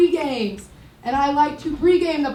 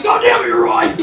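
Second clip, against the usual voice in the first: louder, higher god damn it; mock frustrated shout; 6 semitones higher, 7 dB louder, faster than usual. Comic losing a bar argument with a woman, mock shout. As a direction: concede with a furious yell. Acted quote conceding argument.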